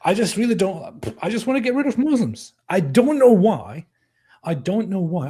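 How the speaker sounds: high, whiny mock confession; mock bigot. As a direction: sarcastically act out a clueless attacker. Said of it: "Did he really say".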